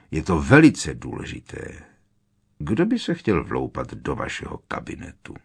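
low growled retort; zavrčení. A narrator's reading. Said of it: "zavrčel Moody". (tiché, hluboké zavrčení)

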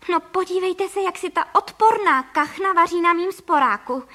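high, loud outrage; outraged storybook exclamation; indignant cry. A narrator's reading. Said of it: "vykřikla paní Frejková".

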